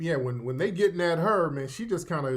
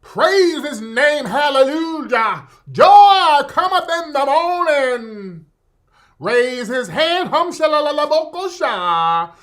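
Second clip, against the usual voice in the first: loud, high-pitched Southern revival preaching; hammed-up Southern preacher, wide-mouthed and stretched; mock Southern Baptist accent; 9 semitones higher, 10 dB louder than usual. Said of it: "doing her mouth all wide".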